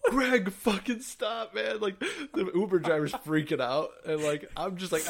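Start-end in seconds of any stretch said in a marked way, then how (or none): 0.1-1.8 put-on high, drawn-out plea to a drunk friend; exasperated, stretched-out telling-off. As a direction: beg him to stop, pitched up and slow. Pleading with drunk friend to stop.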